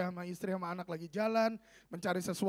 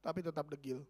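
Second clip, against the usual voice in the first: low, soft afterthought; hushed let-down; 6 semitones lower, 7 dB quieter than usual. Real, not acted. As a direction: drop right down after an outburst. Drops down after the outburst. (suara turun, pelan dan rendah)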